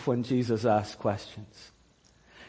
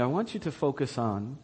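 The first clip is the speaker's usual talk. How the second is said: normal talking voice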